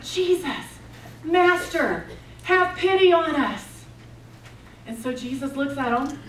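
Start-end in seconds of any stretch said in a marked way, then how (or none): 0.0-3.8 raised, higher-pitched plea; imitated yelling out. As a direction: call out as if from afar, half shouting. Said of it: "they're yelling out".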